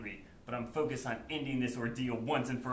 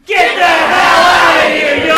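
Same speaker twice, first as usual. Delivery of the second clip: full shout send-off; 13 semitones higher, 24 dB louder than usual. Shown as a demonstration. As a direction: shout it even harder. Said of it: "give them a big".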